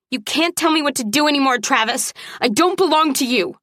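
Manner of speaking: high, heated protest; angry defiance. A narrator's reading. Woman pounding the mattress defies him, angry.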